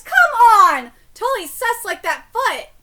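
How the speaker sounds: loud, high, annoyed protest; exasperated outburst. Real, not acted. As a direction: shout in disbelief. Continues ranting.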